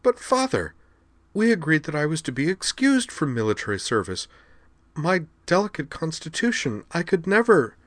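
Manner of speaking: high, whiny spoiled daughter; Xenia pleading. A narrator's reading. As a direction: beg her father in a high fretful voice.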